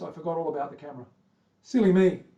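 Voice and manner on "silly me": silly me, sung out high and loud; playful self-scolding. Pretend. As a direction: scold yourself in a bright, teasing voice. Scolds himself for forgetting camera.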